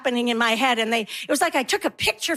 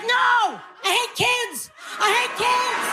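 high-pitched frantic shout; exasperated shout; 9 semitones higher, slower than usual. Acted. Mother losing it at chanting kids, exasperated shout.

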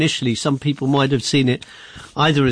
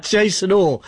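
laughing through the name, higher pitch; 6 semitones higher, slower than usual. Real, not acted. Host thanking guest after his cone joke, laughing. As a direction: sign off through a real laugh.

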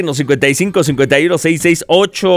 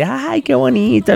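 aunts cooing; 8 semitones higher than usual. Retold or imitated. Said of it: "las tías llorando, así".

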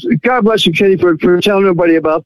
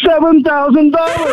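high, elated reveal; excited and proud, pitched well up; thrilled announcement; 8 semitones higher than usual. Real, not acted. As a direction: announce your windfall in delight. Caller reveals his free silver windfall.

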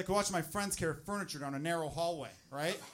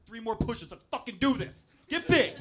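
higher, climbing to a loud push; husband cheering his wife through labor, getting louder; gym spotter pep talk; 6 semitones higher, 7 dB louder, slower than usual. Retold or imitated. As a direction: hype her up like a gym spotter. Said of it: "spotting her at the gym".

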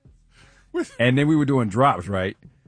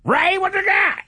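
mock [name]. Host hands over to traffic.

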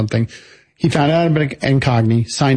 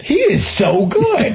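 slow, high, laughing in amazement; drawn-out delighted laugh through words; 10 semitones higher, much slower than usual. Real, not acted. Amazed at the card trick.

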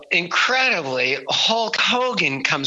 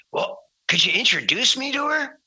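much higher, hopeful request; eager request; 10 semitones higher than usual. Retold or imitated. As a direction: ask like a shy kid hoping for a yes. [name] asks eagerly, high.

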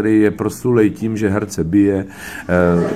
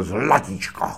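Krejčík sweet-talking; 5 semitones higher than usual. Retold or imitated. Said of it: "on řekl".

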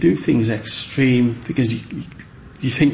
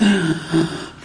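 put-on child's gasp; 6 semitones higher than usual. Child self showing carving.

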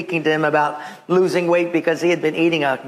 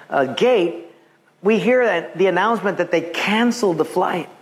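normal talking voice

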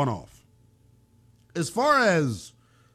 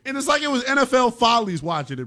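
louder and higher, building hype; fired-up rant; 7 semitones higher, 5 dB louder than usual. Real, not acted. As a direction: get loud and worked up about the blunder. Host rants about Jack Strand.

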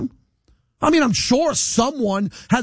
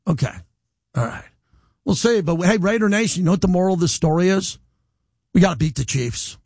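ordinary talk, nothing marked

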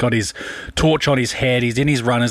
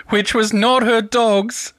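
holding back laughter; 9 semitones higher, slower than usual. Real, not acted. Cracking up at his own story.

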